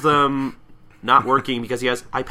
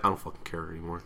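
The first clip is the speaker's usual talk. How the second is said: lower, softer and fast; mock deadpan defeat; 6 semitones lower, 9 dB quieter, much faster than usual. Pony giving up on the fight, mumbled fast. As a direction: toss it off like you gave up. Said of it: "She's like".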